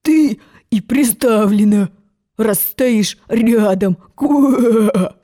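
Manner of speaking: slow, rasping croak; hoarse frog. Acted. Frog telling Alice she minds it, slow rasping croak. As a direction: rasp it out like a frog, hard to understand. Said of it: "Он так хрипел".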